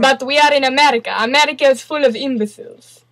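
mock Russian accent. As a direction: answer back in a put-on Russian accent. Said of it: "do this stupid Russian accent".

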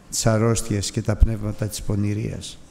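ordinary delivery

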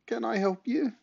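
very high, small squeak; squeaky high voice. A narrator's reading. Boy answers door to neighbour, squeaky high voice. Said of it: "in a squeaky high voice".

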